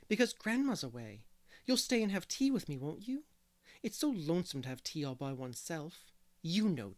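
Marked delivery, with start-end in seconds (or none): 0.1-5.3 eager. A narrator's reading. Boy asks his teacher to stay for tea, eagerly. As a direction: plead eagerly, lifting at the end. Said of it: "he said eagerly".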